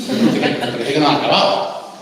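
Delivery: hablando entre risas, más fuerte; explícalo riéndote; riendo. Real, not acted. Se ríe explicando el ejemplo. (comenta el ejemplo riéndose, más fuerte)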